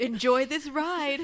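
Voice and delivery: mock sinister ride host; wide-eyed creepy welcome. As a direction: deliver it wide-eyed, half laughing. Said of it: "doing really wide eyes as we're saying it".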